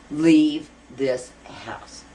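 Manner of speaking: retold command, heavily spaced and emphatic; imitated ghostly command. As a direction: draw out each word like a ghost's demand. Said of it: "somebody comes to me and says".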